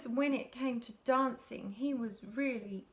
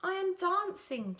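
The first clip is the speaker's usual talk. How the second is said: jubilant cry; 7 semitones higher than usual. Acted. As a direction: crow it out with joy. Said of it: "Gerald cried".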